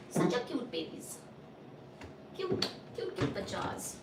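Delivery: soft whispered cooing; hushed whisper over sleeping pets. Real, not acted. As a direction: breathe it out without voice.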